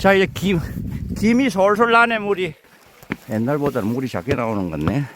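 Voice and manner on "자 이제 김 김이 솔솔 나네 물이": high, bright delight at the steam. Real, not acted. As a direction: marvel at the rising steam. Sees steam rising from spring.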